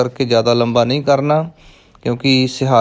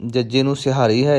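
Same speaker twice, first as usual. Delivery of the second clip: ordinary talk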